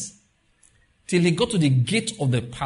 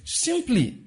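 ordinary talk, nothing marked

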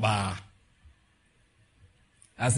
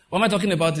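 normal talking voice

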